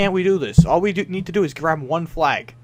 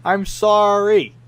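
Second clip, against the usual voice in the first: slow, higher mock apology; sarcastic sorry; 6 semitones higher, 4 dB louder, much slower than usual. Real, not acted. Streamer mocking chat's complaints. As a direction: drag out a fake apology.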